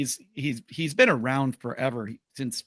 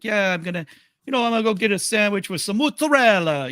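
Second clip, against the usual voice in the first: put-on louder, higher Italian accent; thick Italian accent; 7 semitones higher, 5 dB louder than usual. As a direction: lay on the Italian on mozzarella. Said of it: "with a big, thick accent".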